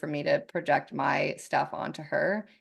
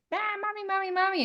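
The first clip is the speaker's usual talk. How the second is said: put-on child calling mommy; 13 semitones higher than usual. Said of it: "her kid's".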